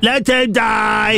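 slow, drawn-out ghostly wail; ghost of an old woman. Retold or imitated. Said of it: "the ghost of an old woman".

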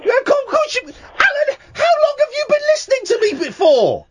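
hammed-up high outrage; mock outrage. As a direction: squawk at the caller in fake indignation. Host mock-outraged at caller.

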